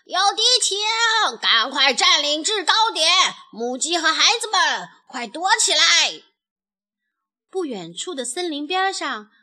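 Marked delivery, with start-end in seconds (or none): 0.0-5.8 loud, high battle alert to the whole yard; shouted alarm. A narrator's reading. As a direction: sound the alarm at full voice. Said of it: "高声喊道". (高声大喊报警)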